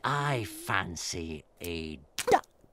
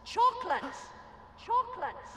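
cave echo; faster than usual. Acted. Voice from the cave echoes back, very high. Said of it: "the voice from the cave replied".

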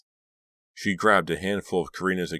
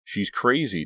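higher-pitched dawning disbelief; shocked realization; 7 semitones higher, 4 dB louder than usual. A narrator's reading. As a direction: let it dawn with shock. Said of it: "Delia realized".

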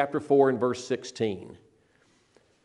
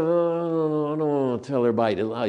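reluctant excuse. Retold or imitated. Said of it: "Most of us would be kind of".

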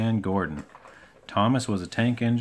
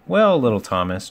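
louder, mildly amused address; chuckled teasing; 2 semitones higher, 7 dB louder than usual. A narrator's reading. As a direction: tease the little one without real laughter. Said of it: "chuckled Gordon".

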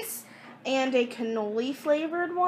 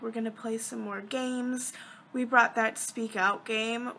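ordinary talk, nothing marked

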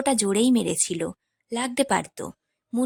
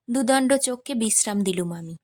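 plain reading voice

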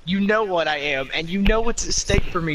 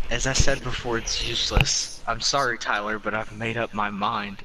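lower, flat, cold finality; cold refusal; 7 semitones lower than usual. Acted. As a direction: shut him down coldly.

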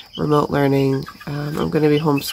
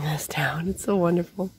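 whisper; 7 dB quieter than usual. Real, not acted. Whispered to camera.

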